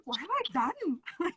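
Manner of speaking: high, slow and soft, aghast at herself; a mock-despairing hushed wail; hushed dismay. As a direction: whisper it like you just realised the damage. Repeated panicked self-quote.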